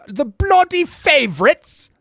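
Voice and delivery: playful British voice; English accent. Acted. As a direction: toss it off in a hammy English accent. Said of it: "English accent".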